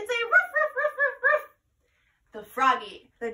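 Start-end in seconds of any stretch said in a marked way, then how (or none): 0.0-1.4 high, playful puppy ruff; dog bark. Shown as a demonstration. Teacher barking like a dog for the kids. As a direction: bark like a happy little dog. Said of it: "and say".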